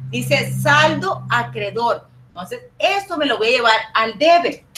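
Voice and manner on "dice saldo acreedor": slow, deliberate repeat so students catch it; slow emphasis. Shown as a demonstration. Teacher stressing the balance for students, slowly. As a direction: spell it out word by word for the class. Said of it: "teacher repeats the balance slowly".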